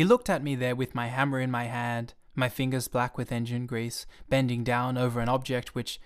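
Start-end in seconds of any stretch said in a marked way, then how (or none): none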